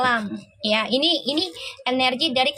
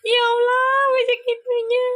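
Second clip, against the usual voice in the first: high amused exclaim; 11 semitones higher than usual. Real, not acted. Amused at Ferel's card.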